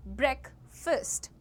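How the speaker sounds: ब्रेकफास्ट as BREK-fust, short vowels; both vowels short. Shown as a demonstration. Teacher modelling the word for students, ब्रेकफास्ट with short vowels. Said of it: "दोनों चीज़ों को छोटा छोटा".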